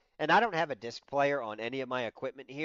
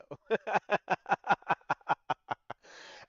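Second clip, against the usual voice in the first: a loud, real laugh; hearty laugh; 6 semitones higher, 4 dB louder than usual. Real, not acted. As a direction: laugh openly at the joke. Laughs about wife joke.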